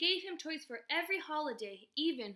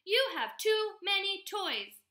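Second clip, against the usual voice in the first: loud, high scolding; yelling mom; 8 semitones higher, 7 dB louder than usual. A narrator's reading. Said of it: "she yelled on her way upstairs".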